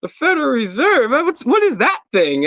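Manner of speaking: curious Reddit users. Retold or imitated. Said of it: "everybody be like".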